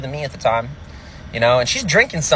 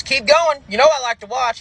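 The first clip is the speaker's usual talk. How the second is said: high, pushed husband voice; husband catching the comic in bed, a high barked challenge; angry husband; 11 semitones higher than usual. Retold or imitated. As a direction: bark it like a caught-out husband with a shotgun. Husband voice continues.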